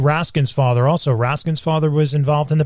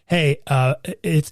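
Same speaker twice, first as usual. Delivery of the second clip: ordinary talk, nothing marked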